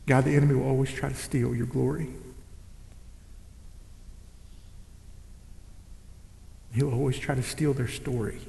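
soft, low, earnest prayer; closing prayer. Acted. Pastor praying softly over the church. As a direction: pray it quietly over the congregation.

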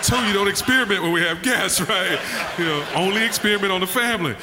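high, loud, laughing through the words. Real, not acted. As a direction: talk while cracking up. Laughing while retelling the dinner.

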